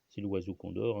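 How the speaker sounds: ordinary delivery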